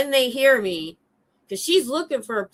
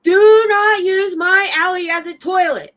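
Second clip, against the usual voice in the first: barking a high, loud order; drill sergeant voice; 12 semitones higher, 9 dB louder than usual. Retold or imitated. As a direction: bellow it like a drill sergeant on parade. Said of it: "drill sergeant voice".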